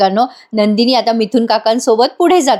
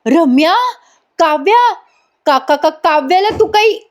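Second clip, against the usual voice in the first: mock shout; 6 semitones higher than usual. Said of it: "मोठ्याने ओरडतात".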